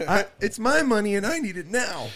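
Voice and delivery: high, hammed-up commercial voice; ad parody. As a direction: belt it like a cheesy TV ad. Quoting J.G. Wentworth ad jingle.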